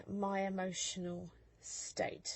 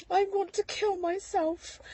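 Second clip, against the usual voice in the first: high, loud retold despair; reliving the desperate confession; distraught plea; 12 semitones higher, 9 dB louder than usual. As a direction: cry of "I want to kill myself" pitched high. Telling doctor she's suicidal.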